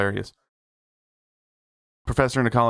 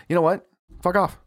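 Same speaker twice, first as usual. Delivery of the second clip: retold telling-off; 9 semitones higher than usual. Retold quarrel with coworker.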